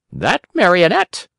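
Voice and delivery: high, scolding little voice; blunt talking cricket. A narrator's reading. Talking cricket scolds the doctors, high and blunt.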